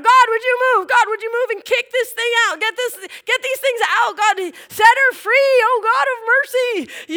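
high, loud pleading with God; desperate loud prayer. Retold or imitated.

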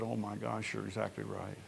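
soft and sheepish; hushed admission. Retold or imitated. Husband quietly admitting his wife was right, hushed. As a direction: concede it quietly, caught out.

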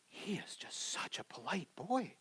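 slow soft whisper of disbelief; amazed whisper. Retold or imitated. Bus driver whispering to the dad, slow and amazed. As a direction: lean in and whisper it slowly.

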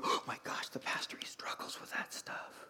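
hushed, scandalised whisper; whispered gasp. Retold or imitated. Congregation whispering in shock about the pastor. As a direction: whisper it like church gossip. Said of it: "And you're going".

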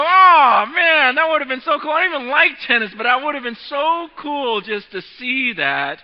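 imagined envious fan, loud and excited; excited wish. Retold or imitated. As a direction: gush like a fan who missed it. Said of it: "Don't you just go".

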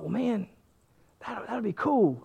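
softly voiced thought to himself; quiet inner thought. Voicing his own private thought.

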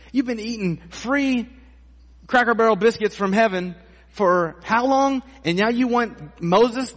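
incredulous scolding. Retold or imitated. Preacher mocks the people's demand in disbelief. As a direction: scold them like fed-up onlookers. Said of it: "And you're thinking".

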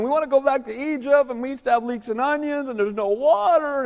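high-pitched whiny grumbling; put-on wail of complainers; mock whining complaint. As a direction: imitate a crowd griping, pitched well up. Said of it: "crying out and murmuring".

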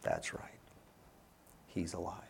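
soft, low, subdued agreement; teacher's hushed reply. Retold or imitated. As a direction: answer the yell very quietly and low. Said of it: "the teacher looked at her and said".

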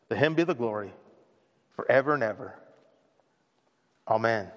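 reading Ephesians to the church, reverent and fading; scripture reading. Acted. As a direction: read the verse with reverence. Reads scripture aloud.

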